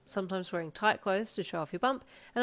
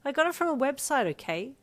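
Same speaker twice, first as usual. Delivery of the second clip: high, louder defensive retort; defensive and a little louder; 7 semitones higher, 5 dB louder than usual. A narrator's reading. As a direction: push back, a bit hurt. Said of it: "you said defensively".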